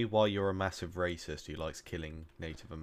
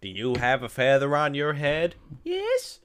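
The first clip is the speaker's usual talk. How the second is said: South Park character voice; 7 semitones higher, 7 dB louder than usual. Retold or imitated.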